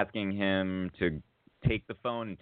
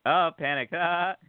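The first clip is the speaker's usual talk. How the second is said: mild, higher-pitched mock panic; 8 semitones higher than usual. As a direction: play up a brief panicked reaction. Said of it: "this one moment of panic".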